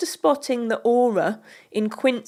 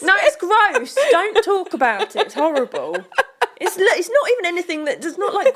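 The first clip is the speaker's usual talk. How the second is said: high, laughing protest; 9 semitones higher, 3 dB louder than usual. Real, not acted. Laughing while protesting nickname.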